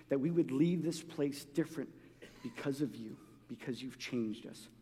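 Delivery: soft, low opening prayer. Acted. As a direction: pray quietly and humbly to God. Opening prayer before sermon.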